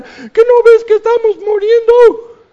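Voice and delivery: very high, loud desperate shout; frantic scream. Retold or imitated. Disciples in the storm screaming loudly at Jesus. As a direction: scream the accusation over the storm. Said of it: "de esta historia que están".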